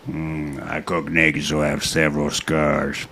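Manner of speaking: low, soft grunting Sling Blade; low Sling Blade voice, grunting at the ends; Sling Blade impression. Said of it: "I can do a pretty good Sling Blade".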